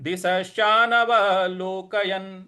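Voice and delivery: slow even chanting of verse; full verse recitation. Shown as a demonstration. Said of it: "इस प्रकार से हो जाएगा". (पूरा श्लोक पाठ)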